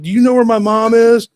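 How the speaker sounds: put-on high, loud panicked kid; urgent mock-desperate question; frantic. Kid phoning friend's mom about missing mother, frantic.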